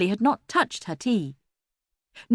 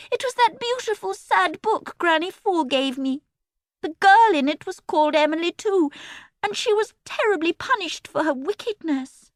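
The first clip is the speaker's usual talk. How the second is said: put-on pious girl voice; 11 semitones higher than usual. A narrator's reading. Pious Emily continues.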